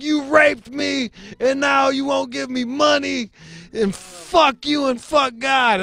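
very high, slow mock wail; retold shrieked accusation. Woman accusing a man on the street, hysterical mock outrage. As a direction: imitate her screeching accusation.

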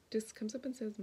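ordinary talk

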